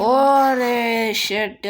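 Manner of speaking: loud, very drawn-out groaning curse; dismayed yell. Real, not acted. Player falls and loses the round, dismayed yell. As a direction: stretch the cry out in dismay.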